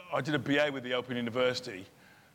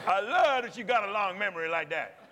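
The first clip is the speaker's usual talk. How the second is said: higher, laughing fan voice imitating the crowd; cheering park audience; 7 semitones higher than usual. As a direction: praise the actor like an excited fan. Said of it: "they went".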